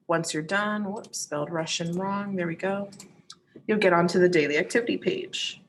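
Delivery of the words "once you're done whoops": ordinary delivery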